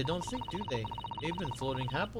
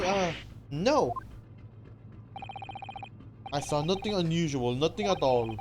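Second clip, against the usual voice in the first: put-on Russian sailor accent. Sailor boasting about the first class area, Russian accent. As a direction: puff up like a guard in Russian accent.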